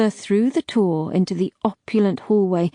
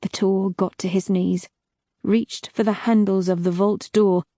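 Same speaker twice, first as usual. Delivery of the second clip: ordinary delivery, nothing marked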